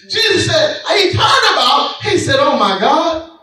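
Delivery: high-pitched sermon storytelling; impassioned preaching. Real, not acted. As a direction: proclaim the Bible story to the congregation. Preaches at full force.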